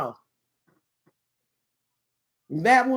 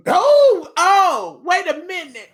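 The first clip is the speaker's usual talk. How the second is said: high, loud burst of surprise; startled exclamation; 7 semitones higher, 6 dB louder, slower than usual. Real, not acted. Reacting to what my love put there, startled. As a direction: exclaim it, then halt everything.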